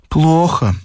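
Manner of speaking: lightly voiced lady patient; complaining lady. A narrator's reading. Lady answers the doctor about her sleep, complaining.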